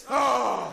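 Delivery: sudden loud cry after the sneeze; startled shout; loud yell. Shown as a demonstration. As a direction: let out a big booming ah.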